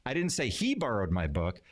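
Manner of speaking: "he" punched above the other words; stress on HE. Shown as a demonstration. Teacher showing how emphasis changes meaning, stress on "he". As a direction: hit the word "he". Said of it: "putting emphasis on different words".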